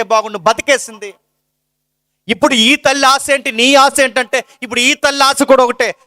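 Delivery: sermon at full force; fervent preaching. Acted. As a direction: preach it out to the congregation.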